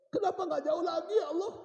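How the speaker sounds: high-pitched plea to God; acted cry of regret, held back in volume; tearful lament. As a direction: plead upward, as a dying man. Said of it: "Suamiku menangis".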